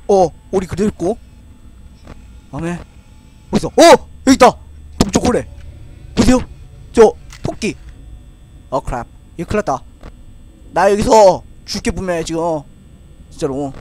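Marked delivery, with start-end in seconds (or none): none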